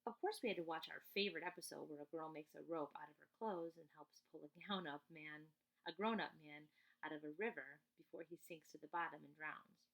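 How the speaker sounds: ordinary delivery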